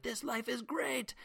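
high, drawn-out mock scream, held back in volume; mock rooftop scream. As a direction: pretend to scream it from the rooftops without real volume. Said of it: "scream from the rooftops".

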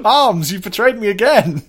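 loud, hammed-up anguished cry; mock dramatic outcry. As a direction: shout it like a betrayed hero.

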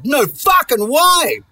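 high, slow, drawn-out disbelief; stunned disbelief. Retold or imitated. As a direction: stretch it out in amazement. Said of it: "But also I was like".

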